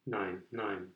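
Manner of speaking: low, soft, resigned refusal; understated sadness, voice sinking; sad. A narrator's reading. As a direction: decline softly with regret. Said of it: "sagte Jürgen traurig".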